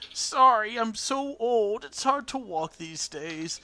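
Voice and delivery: put-on high, slow, labouring old-lady voice; aged and apologetic, drawn out; crotchety old woman. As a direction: drag it out like an old woman climbing stairs. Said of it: "the little crotchety woman".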